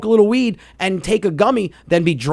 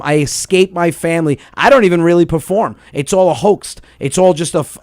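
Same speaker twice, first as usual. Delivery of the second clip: usual speaking voice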